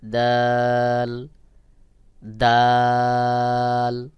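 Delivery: দাল thin and natural, held very long. Shown as a demonstration. Teacher models the Arabic letter, thin drawn-out দাল. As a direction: stretch a thin দাল slowly. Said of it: "স্বাভাবিকভাবে পাতলা করে উচ্চারণ হবে".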